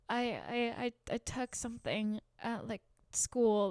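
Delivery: timid confession. Retold or imitated. Confessing to mom, sick and scared.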